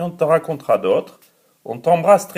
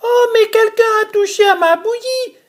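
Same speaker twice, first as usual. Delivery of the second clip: very high, fast squeaky little bear; tiny bear's voice; 4 dB louder, much faster than usual. Acted. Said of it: "de sa toute petite voix".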